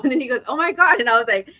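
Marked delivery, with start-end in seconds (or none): 0.5-1.0 higher, louder retold "oh my god"; sudden guilty realization, played up; hammed-up startled husband; mock dismay. Husband realizing he forgot door.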